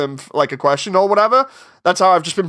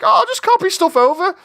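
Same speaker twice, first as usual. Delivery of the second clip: naive cheerful; 13 semitones higher than usual. Retold or imitated. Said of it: "I'm like".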